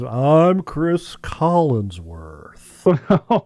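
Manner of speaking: slow, drawn-out announcer impression; mock commentator sign-on, hugely elongated; Chris Collinsworth. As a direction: stretch out the self-introduction in mock broadcaster style. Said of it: "the Collins".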